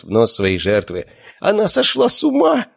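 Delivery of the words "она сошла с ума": very high panicked squeal; shrieking lady. A narrator's reading. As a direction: shriek as a horrified dog owner. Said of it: "верещала хозяйка собаки".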